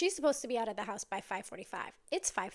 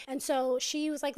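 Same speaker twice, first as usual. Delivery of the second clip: ordinary talk, nothing marked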